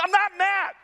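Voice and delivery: high-pitched, insistent protest; hammed-up retort of someone clearly mad; indignant denial. Dad told by son that he is mad, indignant denial.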